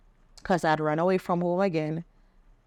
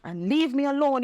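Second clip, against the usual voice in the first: mad; 5 semitones higher than usual. Retold or imitated. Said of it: "I was so mad".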